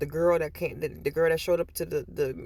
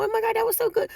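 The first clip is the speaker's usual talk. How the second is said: much higher and louder gushing; mock excited caller; 5 dB louder, faster than usual. Imitating people calling about it.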